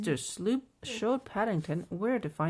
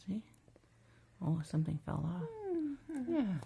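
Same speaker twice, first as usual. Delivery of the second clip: ordinary delivery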